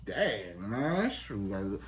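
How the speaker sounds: low, soft, impressed muttering; quietly taken aback. Acted. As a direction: mutter it to yourself, stunned. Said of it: "if a woman told me that".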